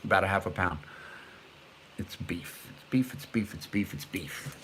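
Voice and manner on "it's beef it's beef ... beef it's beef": very soft, lower repeated murmur; hushed, to yourself; muttered repetition. Real, not acted. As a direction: say it over and over under your breath. Repeats it low to self.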